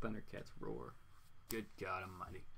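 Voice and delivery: very soft, low and weary aside; hushed, dropped-pitch disbelief; dismayed aside. Real, not acted. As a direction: say it low, under your breath. Dismayed aside after laughing.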